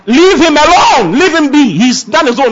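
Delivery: rally them to take charge now; fervent preaching. Acted. Preacher urging hurt listeners to let their fathers go, fervent.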